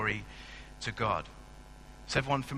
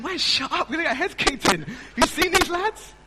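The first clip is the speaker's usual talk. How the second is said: high, loud, laughing panic; mock panicked whisper-shout; 9 dB louder than usual. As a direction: hush your brother in comic terror. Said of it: "I'm sitting there going".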